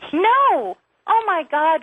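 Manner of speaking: loud, high cry of protest; outraged outburst. Acted. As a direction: burst out in outrage. Outraged caller rejects the accusation.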